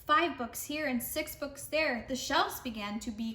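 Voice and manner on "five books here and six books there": usual speaking voice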